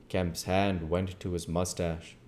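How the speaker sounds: ordinary delivery, nothing marked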